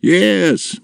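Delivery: high, lifted affirmation; bright-pitched, encouraging yes; encouraging father. A narrator's reading. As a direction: assure the giant eagerly.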